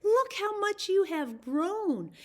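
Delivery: bright, high bird voice greeting the tree; a cheerful bird calling hello; Wise Bird's voice. A narrator's reading. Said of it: "Wise Bird came by and said".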